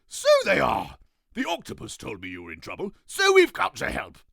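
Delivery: a grand king greeting; pompous king. Acted. King voiced.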